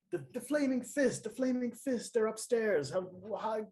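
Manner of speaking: high, breathless alarm; panicked warning. Acted. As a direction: blurt it out in a panic about the fist upstairs. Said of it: "I'm like".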